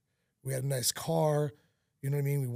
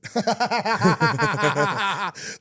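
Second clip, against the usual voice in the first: loud, higher laugh breaking through tears; real emotional burst of laughter; tearful laugh; 7 semitones higher, 9 dB louder than usual. Real, not acted. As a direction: laugh to keep from crying. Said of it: "that laugh helped".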